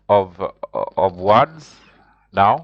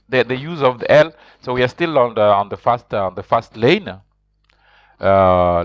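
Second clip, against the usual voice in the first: ordinary talk, nothing marked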